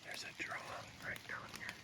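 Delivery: hushed whisper to camera; hunter's whisper. Real, not acted. Hunter on a ridge updating viewers from the stand, whispered.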